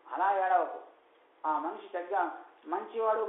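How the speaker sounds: ordinary talk, nothing marked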